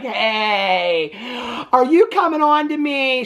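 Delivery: drawn-out teasing 'hey'; mock suggestive. Vlogger voicing a movie villain's imagined come-on, mock suggestive. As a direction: stretch it out like a hammed-up come-on.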